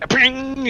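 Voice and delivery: high, ringing 'ping' sound effect; cartoony high ping; imitated metallic ping. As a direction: make a high pop-out ping noise. Imitates armor popping back out.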